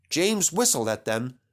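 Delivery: ordinary delivery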